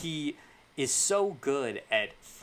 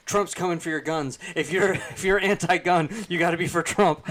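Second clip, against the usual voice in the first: loud hammed-up slogan; mock campaign shout; 6 semitones higher, 8 dB louder than usual. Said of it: "it's like".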